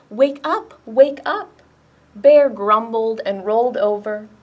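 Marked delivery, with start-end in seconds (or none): 0.1-1.6 higher, urgent rising wake-up call; crying out. A narrator's reading. Ladybug waking snoring Bear, urgent cry. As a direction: rouse a sleeper, pitch lifting on each phrase. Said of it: "cried Ladybug".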